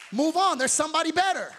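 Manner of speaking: preacher shouting at the heartbroken to move on; forceful shout. Real, not acted. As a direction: shout the answer at them. Preacher answers the complaint.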